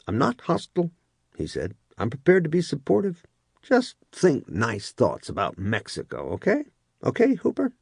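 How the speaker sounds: a hint of a falsely supportive boss; first sergeant.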